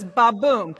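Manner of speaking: ba-boom with BOOM hit hard; "ba-boom", light first beat, heavy second; stress on second syllable. Shown as a demonstration. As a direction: punch the boom in "ba-boom". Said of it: "second one gets the emphasis".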